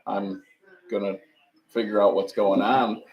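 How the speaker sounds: usual speaking voice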